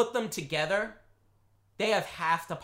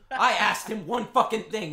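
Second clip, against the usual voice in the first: ordinary talk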